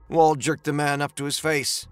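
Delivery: usual speaking voice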